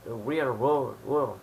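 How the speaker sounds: self-mocking English accent on "real world"; a passing stab at an English accent on "world". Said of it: "Excusez-moi pour mon accent médiocre".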